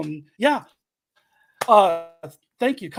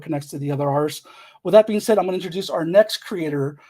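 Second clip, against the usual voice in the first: ordinary talk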